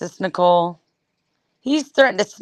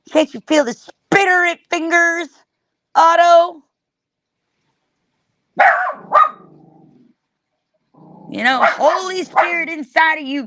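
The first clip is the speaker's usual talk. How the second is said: higher, mock cheerleader pumping up Otto; hammed-up motivational coaxing, pitched up; mock hype pep talk; 5 semitones higher than usual. Streamer hams up a pep talk to Otto, higher and eager.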